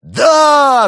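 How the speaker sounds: very high, drawn-out, full-force yell; piercing battle cry. A narrator's reading. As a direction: scream it like a crowd of kids charging. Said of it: "завопили ребята".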